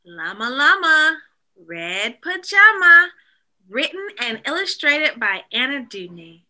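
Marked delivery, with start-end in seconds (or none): none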